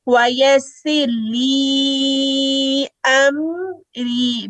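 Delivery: drawn-out chanted वयस्सिर ली Amari; slow recitation. Shown as a demonstration. Teacher reciting the verse slowly for students. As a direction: recite slowly, stretching each syllable.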